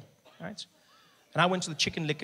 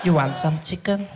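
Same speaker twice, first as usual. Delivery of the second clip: slow, louder flirty cashier voice; 2 semitones higher, 7 dB louder than usual. Retold or imitated. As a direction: come on to him slowly. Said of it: "She's like".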